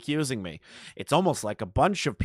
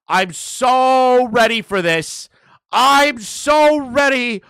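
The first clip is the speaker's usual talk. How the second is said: higher, louder, slower gloat; gloating yell; 11 semitones higher, 10 dB louder, much slower than usual. Real, not acted. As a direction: yell it out, drawn out.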